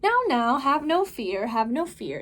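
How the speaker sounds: put-on the Cat in the Hat calming the children, big and upbeat. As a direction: reassure the kids with a grand flourish.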